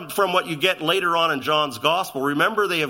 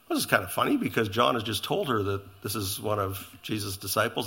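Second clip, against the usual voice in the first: normal talking voice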